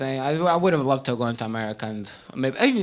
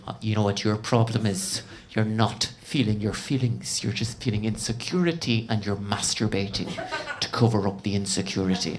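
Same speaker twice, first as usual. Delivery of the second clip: put-on so-so Northern Irish accent, lower, blunt therapist; a not-great Northern Irish therapist, pitched lower; 7 semitones lower, 4 dB quieter than usual. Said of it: "not a great Northern Irish accent".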